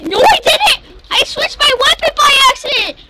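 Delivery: high, rushed protesting shout; indignant high-pitched denial. Real, not acted. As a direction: yell your excuse in a hurry. Protesting loudly to teammate.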